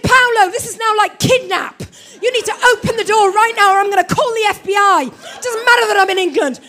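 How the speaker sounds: wife shouting threats at husband through locked door, loud and angry; furious shout. Retold or imitated. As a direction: yell the threat at full volume. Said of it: "'Cause I'm just furious".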